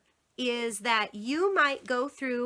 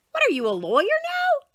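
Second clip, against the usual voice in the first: high, fast incredulous jab; mock surprise; 6 dB louder, faster than usual. Mother teasing her bargaining son, high fast mock surprise. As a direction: tease him with fake shock.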